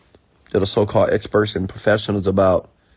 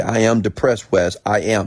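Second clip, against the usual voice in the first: challenging insistence; 3 semitones higher, 6 dB louder than usual. Retold or imitated. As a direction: push back hard on AM, imitating an arguer. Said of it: "they will challenge me".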